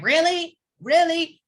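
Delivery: imitating her eye-rolling scorn; hammed-up exasperated really; incredulous mock sass. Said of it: "And she's like".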